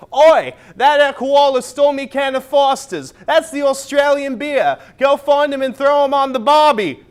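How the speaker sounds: loud, high hammed-up Australian accent. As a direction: shout it like a cartoon Aussie bloke. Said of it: "such features as".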